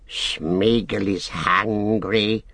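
slow, drawn-out creature voice; eerie character voice, very slow; Gollum's creature voice. A narrator's reading. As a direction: stretch the words out like a strange, wheedling creature. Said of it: "said Gollum".

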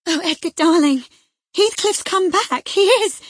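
very high, loud, overjoyed outburst; wild excitement. A narrator's reading. Wife flings arms round husband to announce a return, wildly excited. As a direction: burst out with the news, frantic with joy. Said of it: "too excited to show gladness".

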